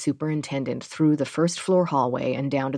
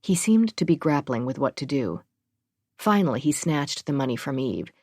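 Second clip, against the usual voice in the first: ordinary delivery, nothing marked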